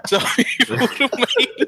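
laughing through the words, slow; words broken up by real laughter; giggling while trying to get back to rugby. Real, not acted. Laughing after pangolin tangent.

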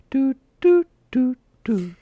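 high, evenly spaced "doot" beeps; imitated charging beeps. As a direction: mimic a slow charger, high-pitched little toots. Imitating slow trickle charging.

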